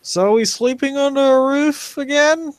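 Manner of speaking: high, loud heckling call from the crowd; crowd heckler shout. Acted.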